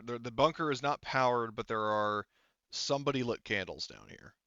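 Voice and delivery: ordinary talk, nothing marked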